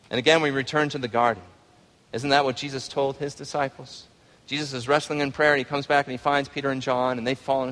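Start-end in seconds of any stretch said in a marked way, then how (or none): none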